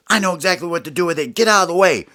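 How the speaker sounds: fast, cocky high voice; Ollie's voice. Acted. Ollie brushing Smalls aside to grab the board. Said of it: "and Ollie goes".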